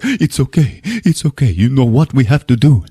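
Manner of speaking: put-on Spanish-accented soothing voice; Javier. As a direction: plead gently in an imitated Spanish accent. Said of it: "And Javier's like".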